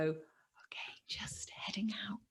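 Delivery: very soft, whispered announcement; hushed, furtive aside; sneaky whisper. Acted. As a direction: whisper it as you slip away unnoticed. Acts sneaking out from the kids.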